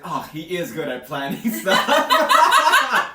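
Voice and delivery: drawn-out, high, loud gush broken by laughter; slow, giddy imitation dissolving into laughs; laughing mock gush. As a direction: swoon over him while cracking up. Mocking Lucy's admiring gush.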